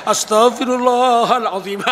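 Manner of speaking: slow, drawn-out prayer dissolving into mad laughter; acted crazed repentance with laughter; crazy laughing istighfar. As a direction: draw out the words, then burst out laughing. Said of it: "Itu gila, itu gila".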